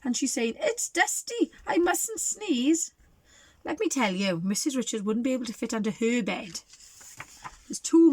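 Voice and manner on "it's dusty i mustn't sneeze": put-on high, slow old granny; grandmother voice. Grandmother voiced under bed.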